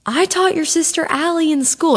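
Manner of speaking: high, bright retold lady's line; a hint of the sweet hostess explaining, pitched high. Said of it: "she goes".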